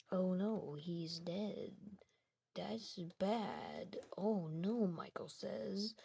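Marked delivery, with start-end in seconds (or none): none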